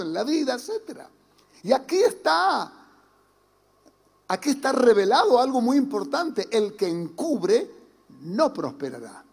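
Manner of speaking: ordinary talk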